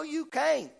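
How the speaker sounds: ordinary talk, nothing marked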